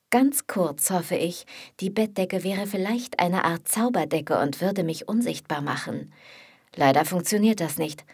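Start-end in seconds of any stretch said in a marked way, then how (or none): none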